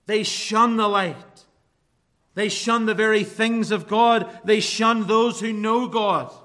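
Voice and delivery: fervent pulpit preaching. Acted. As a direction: preach it with rising conviction. Preacher building to full force.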